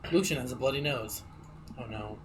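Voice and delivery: ordinary talk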